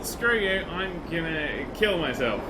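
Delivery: normal talking voice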